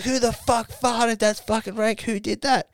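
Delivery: high-pitched appalled demand; mock disgust. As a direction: imitate a revolted group hunting the culprit. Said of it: "who was disgusted by my fart".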